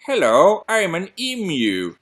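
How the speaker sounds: Hello, I'm an emu with emu as EE-myoo; British emu demo. Shown as a demonstration. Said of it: "Sounds so much nicer".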